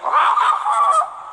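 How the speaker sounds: high yelp like a wounded dog. Retold or imitated. Brother yelping at the first spank, like a hurt dog. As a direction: yelp as the spoon hits. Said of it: "That sounds like a wounded dog".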